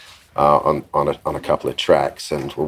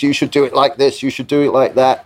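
higher, insistent instructions; 6 semitones higher than usual. Retold or imitated. Visitor tells the producer how to run things, insistent. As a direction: lightly imitate someone handing out directions. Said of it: "[name] gives another couple of things".

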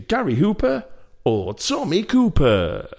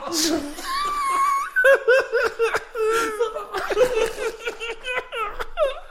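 high shrieking laugh; squeaky gleeful gremlin giggle. Real, not acted. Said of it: "sound like a gremlin".

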